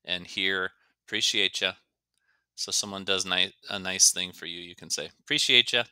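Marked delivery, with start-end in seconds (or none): 1.1-1.7 "Appreciate ya" as "preciate-cha"; casual reduced "Appreciate ya", no first vowel. Shown as a demonstration. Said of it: "is reduced to ya". 5.2-5.8 "Appreciate ya" higher and louder, as "preciate-cha"; casual thanks, "Appreciate ya" run into one word; reduced to "ya". Shown as a demonstration. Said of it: "is reduced to ya".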